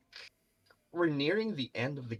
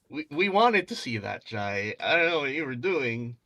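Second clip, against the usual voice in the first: excited teasing; 4 semitones higher, 8 dB louder than usual. Real, not acted. Cheering a missed game-ending play.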